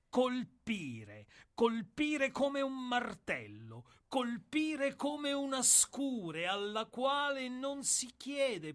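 building from firm to loud and high, slow and hammering; rising intensity. Acted. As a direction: pound each colpire harder like a hammer blow.

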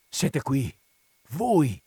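startled, rising up loud on voi; old priest startled to see Renzo; surprised exclamation. A narrator's reading. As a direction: caught off guard, blurting it out. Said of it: "esclamò Don Abbondio".

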